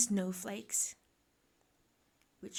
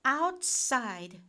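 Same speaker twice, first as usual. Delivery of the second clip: loud, raised-pitch motherly order; exasperated Mum; 4 semitones higher, 6 dB louder than usual. A narrator's reading. Mum ordering son out to play, exasperated. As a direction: send the child out firmly.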